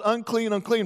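ordinary talk, nothing marked

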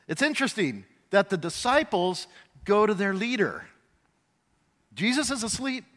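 ordinary talk

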